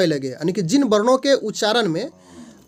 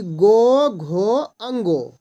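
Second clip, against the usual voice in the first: slow sounding out; much slower than usual. Shown as a demonstration. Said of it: "teacher sounds out letters".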